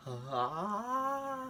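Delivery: short high imitated yelp of pain; mock pain scream. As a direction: yelp it like a sudden jolt of pain. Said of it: "I'm sitting there screaming".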